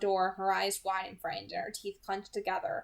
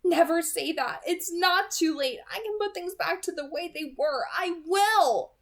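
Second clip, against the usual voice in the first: sharp, loud and higher; 9 semitones higher, 7 dB louder than usual. A narrator's reading. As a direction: whip the words out fiercely. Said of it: "Her voice whipped out, low and sharp".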